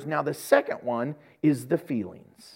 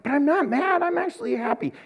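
much higher, indignant protest; protesting self; 11 semitones higher than usual. Retold or imitated. Husband answering his wife in the kitchen, protesting high. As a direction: insist you're not mad, pitch up. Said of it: "And I'm like".

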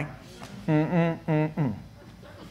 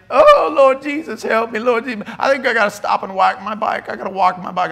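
hammed-up wail of a suffering cyclist; mock whining; 3 semitones higher than usual. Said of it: "his legs was whining and crying and burning".

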